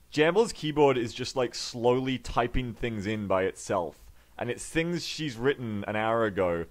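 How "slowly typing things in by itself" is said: ordinary talk, nothing marked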